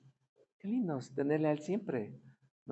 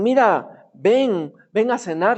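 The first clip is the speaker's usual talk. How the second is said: raised, high-pitched call to the table; calling out to dinner; 8 semitones higher, 12 dB louder, slower than usual. Retold or imitated. Lady of the house calling the praying pastor to dinner, loud high call. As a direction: call out loudly, as if across the house. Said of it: "le, le gritan".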